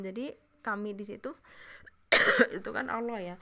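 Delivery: talking through coughs; words broken by a cough; coughing fit. Real, not acted. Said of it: "maaf, ini batuk".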